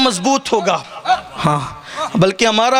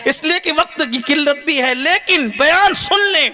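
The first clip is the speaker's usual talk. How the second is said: full-force preaching; 8 semitones higher than usual. Acted. Preacher praising Ghaus Pak to the crowd, impassioned high preaching. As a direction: raise the whole hall with rising fervour.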